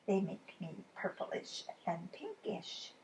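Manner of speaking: soft, slow, hushed; whispered aside. A narrator's reading. Ted whispering his raspberry secret to the boy, hushed.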